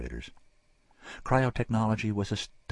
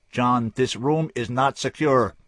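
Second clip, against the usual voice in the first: loud, slow, cutting order; whip-crack command; 7 semitones higher, 8 dB louder, slower than usual. Acted. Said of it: "Her voice cracked like a whip".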